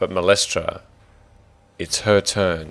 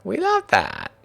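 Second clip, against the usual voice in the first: high, louder put-on impression; Emily; 5 dB louder than usual. Game master repeats the praised impression, high-pitched. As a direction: push the mimicry higher and louder. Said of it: "really good Emily impression".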